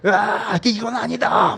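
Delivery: high, loud mock scream of dread; acted panicked cry. Inner voice before the paragliding jump, hammed-up panicked cry. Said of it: "막 이렇게 막 이렇게".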